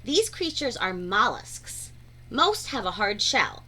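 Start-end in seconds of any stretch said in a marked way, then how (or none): none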